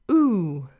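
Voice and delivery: "oo" drawn out as in "pool"; isolated long "oo" for learners; long U sound. Shown as a demonstration. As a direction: hold "oo" like the vowel in "soup". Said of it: "This is our long U sound".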